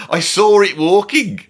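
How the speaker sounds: put-on high, slow German accent. Comedian amused by lyrics, reading them in mock German accent. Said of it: "saying these rock and roll lyrics with a German accent".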